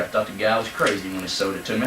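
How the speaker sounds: ordinary talk, nothing marked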